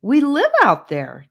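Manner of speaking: put-on high, loud eager boy; eager explaining. A narrator's reading. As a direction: rush to explain. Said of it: "Gabe tried to explain".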